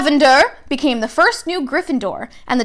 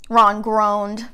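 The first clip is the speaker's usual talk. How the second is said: plain reading voice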